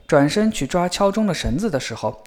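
plain reading voice

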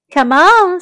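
rally friends to come along; calling out. A narrator's reading. Tom invites Millie and Molly along, calling out.

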